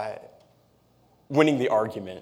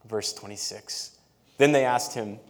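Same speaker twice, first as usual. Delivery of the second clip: normal talking voice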